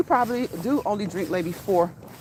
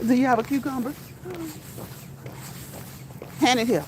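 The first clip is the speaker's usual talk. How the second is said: cheeky guest asking for food; mock demand; 6 semitones higher than usual. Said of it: "I'm gonna be like".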